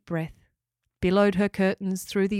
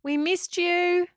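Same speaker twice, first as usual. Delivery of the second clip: calling out; 11 semitones higher, slower than usual. Acted. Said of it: "they called out".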